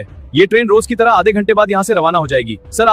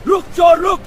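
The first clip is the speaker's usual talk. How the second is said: shouting after thief; 10 semitones higher than usual. Acted. Chasing the thief.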